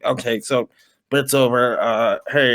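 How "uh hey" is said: ordinary talk, nothing marked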